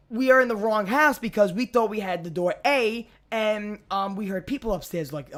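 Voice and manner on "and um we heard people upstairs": ordinary talk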